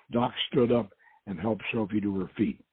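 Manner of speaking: ordinary delivery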